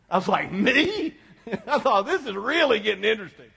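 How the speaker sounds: high, laughing disbelief. Retold or imitated. Pastor laughing at the weeping man's answer, amused. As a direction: chuckle through the surprised reaction.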